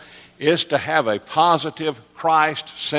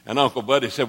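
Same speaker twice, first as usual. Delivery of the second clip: ordinary talk, nothing marked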